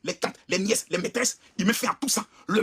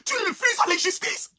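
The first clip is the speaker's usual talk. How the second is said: slow, higher lament to God; slow, high lament calling on God; pleading prayer; 4 semitones higher, slower than usual. Acted. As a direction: cry out to the Lord slowly.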